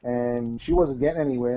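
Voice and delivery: ordinary talk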